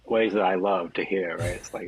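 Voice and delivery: normal talking voice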